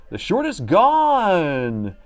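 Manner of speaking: high, slow stretched announcement; weird voice. Acted. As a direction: drawl it out in a funny put-on voice. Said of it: "I really don't know why I say it like that".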